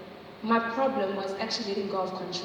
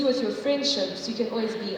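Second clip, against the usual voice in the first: ordinary talk, nothing marked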